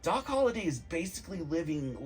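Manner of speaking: usual speaking voice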